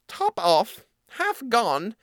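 high little mouse voice; pondering mouse. Acted. Mouse mulling odd names, high and puzzled.

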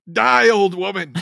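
high-pitched comic attacker's cry; mock battle cry. Player mocks stabbing a little old lady, mock battle cry.

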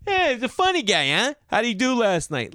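hammed-up snooty member asking about the comedian; nosy club member. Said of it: "They all know each other".

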